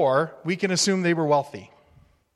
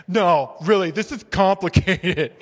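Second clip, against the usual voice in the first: mock whiny insistence, slower on "this is complicated"; insistent protest; 3 semitones higher, 5 dB louder, slower than usual. Preacher mimicking people protesting to God, mock insistent. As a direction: playfully insist it's really complicated. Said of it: "We're like".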